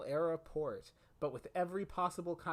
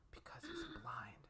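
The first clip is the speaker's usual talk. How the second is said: whisper; 11 semitones higher, 12 dB quieter than usual. Acted. Whispered aside in movie recap.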